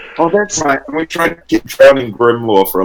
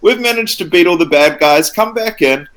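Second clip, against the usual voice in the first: character calling out; 8 semitones higher than usual. Acted. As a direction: call them back inside, all clear. Player voices character calling others in.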